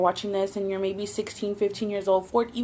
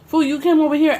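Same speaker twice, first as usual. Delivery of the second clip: higher, louder scolding of him; mock scolding; 6 semitones higher, 6 dB louder than usual. Retelling scolding her man, higher and louder. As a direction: scold him, raised and sharp. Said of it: "And I was like".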